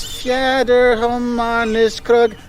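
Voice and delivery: slow, laboured reading of a foreign word; sounding out Swedish. Shown as a demonstration. Tourist reading a baffling Swedish sign, slowly sounded out.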